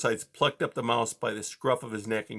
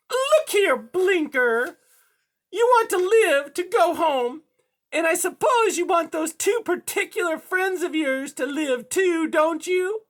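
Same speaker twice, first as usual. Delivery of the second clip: high, loud, menacing cat villain; high-pitched sinister coaxing; threatening cat; 7 dB louder than usual. A narrator's reading. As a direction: deliver the threat in a piercing high voice. Cat threatens mouse's friends.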